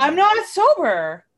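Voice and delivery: high, loud, drawn-out denial; loud protest. Real, not acted. Tipsy guest protesting to cohost's teasing, loud indignant denial. Mock protest at being called sober.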